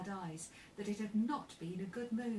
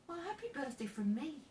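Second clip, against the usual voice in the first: higher, lifted birthday wish; forced cheerfulness; 7 semitones higher than usual. A narrator's reading. Joe wishes Amy happy birthday, as cheerfully as he can. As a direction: make an effort to sound cheerful. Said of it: "as cheerfully as he could".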